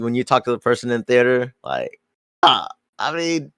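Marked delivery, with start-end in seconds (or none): 2.4-3.5 high-pitched, hedging imitation of an unsure moviegoer; mock hesitant approval. Moviegoer asked for a reaction in the theater, mock hesitant approval. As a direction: start slow and hesitant, then push it high and loud.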